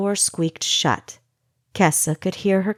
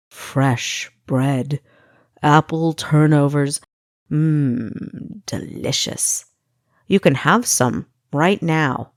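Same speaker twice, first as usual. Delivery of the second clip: lower, savouring, teasing Pastry Cook; a hint of a whispered "delicious"; tempting captor; 3 semitones lower than usual. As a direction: tempt her, relishing every word. Pastry Cook tempts Kessa with food.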